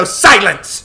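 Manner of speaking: loud, high roared command; furious roar. A narrator's reading. Artemis interrupts Joshua's excuse, furious roar. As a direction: roar over him to silence him. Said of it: "she roared".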